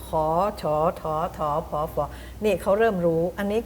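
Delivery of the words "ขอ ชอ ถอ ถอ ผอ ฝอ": ขอ ชอ ถอ ถอ ผอ ฝอ each with a rising tone; high-class consonant tone. Shown as a demonstration. Teacher reciting high-class consonants, ขอ ชอ ถอ ถอ ผอ ฝอ in rising tone. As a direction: recite ขอ ชอ ถอ ถอ ผอ ฝอ one by one, each swooping upward. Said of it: "เริ่มเสียงสูงแล้ว".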